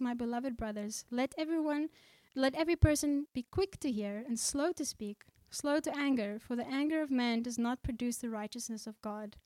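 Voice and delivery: ordinary talk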